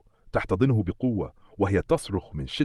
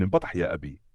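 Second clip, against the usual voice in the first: plain reading voice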